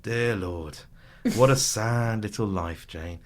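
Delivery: withering put-down. Retold or imitated. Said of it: "he kind of looks at her and he goes".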